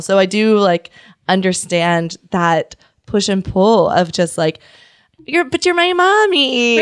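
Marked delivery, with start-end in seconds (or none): 5.3-6.8 put-on high, little-girl plea; childlike plea. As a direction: plead like a small child to her mother. Voicing herself as a child.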